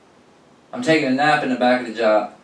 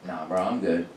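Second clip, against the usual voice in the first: lower, softer dismissive reply; flat refusal; 7 semitones lower, 9 dB quieter than usual. Retold or imitated. Cashier turning down the weekend shift, unimpressed. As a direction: shrug off the manager's offer.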